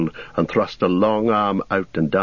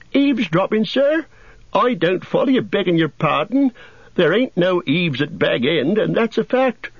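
much higher voice, eavesdropping rising in mock surprise; Sam, playing innocent; 12 semitones higher than usual. A narrator's reading. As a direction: repeat the word back as if baffled, high and rustic. Sam feigns innocence to Gandalf.

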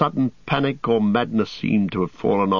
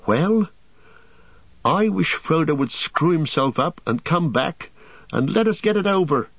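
put-on high-pitched, restless young hobbit; fretful hobbit, pitched well up; high voice, eager to be done; impatient Pippin; 7 semitones higher than usual. A narrator's reading.

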